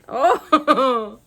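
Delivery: ordinary delivery, nothing marked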